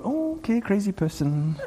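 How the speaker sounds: hushed, humouring aside; wary dismissal. Acted. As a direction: back away softly from an overexcited person. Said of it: "people get excited about things and we go".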